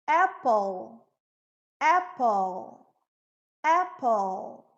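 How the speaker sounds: "Apple" closing on a held dark L; dark L ending. Shown as a demonstration. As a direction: draw out the final L of "Apple". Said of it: "é um dark L". ("Apple" com L escuro no final)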